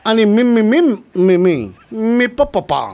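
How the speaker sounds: hammed-up Chinese accent, sung syllables; mock Chinese accent. Guest hams up the accent game with sing-song syllables.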